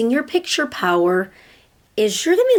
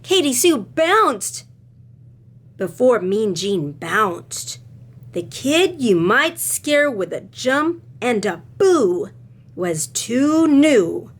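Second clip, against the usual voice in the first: slow, sing-song read-aloud; reading to children; 5 semitones higher, slower than usual. A narrator's reading. Teacher reading a picture book to her class, sing-song. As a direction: read it with rhythm for little listeners.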